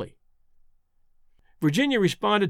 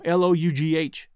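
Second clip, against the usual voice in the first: normal talking voice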